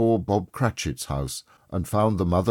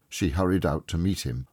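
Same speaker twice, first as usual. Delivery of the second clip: plain reading voice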